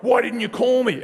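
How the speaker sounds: high, loud mock reproach; retold indignant mate. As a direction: imitate a mate's indignant complaint. Retells best mate scolding him.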